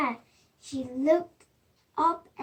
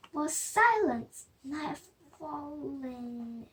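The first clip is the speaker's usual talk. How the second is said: plain reading voice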